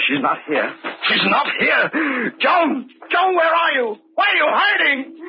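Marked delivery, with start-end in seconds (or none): 1.1-5.2 frantic crying shout. Acted. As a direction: scream her name, breaking down. Searching for fallen wife, frantic.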